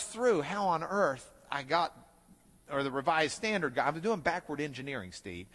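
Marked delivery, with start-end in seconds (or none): none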